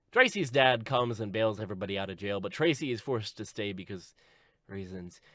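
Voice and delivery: usual speaking voice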